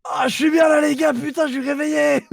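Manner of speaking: high, loud wired shouting; too much caffeine. Acted. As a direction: shout like you're buzzing on caffeine. Player acts wired character.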